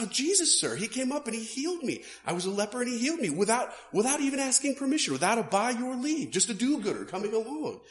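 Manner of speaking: comic peasant moaning about his cure; aggrieved ex-leper. Retold or imitated. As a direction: rattle off the grievance like a wronged tradesman. Ex-leper complains about being healed.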